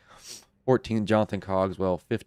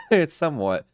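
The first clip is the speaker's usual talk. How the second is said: laughing cough breaking into words; 9 semitones higher, 8 dB louder than usual. Real, not acted. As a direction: cough fit then a few words. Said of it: "Oh, you're coughing there".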